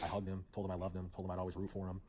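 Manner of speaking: normal talking voice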